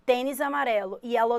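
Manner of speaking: ordinary talk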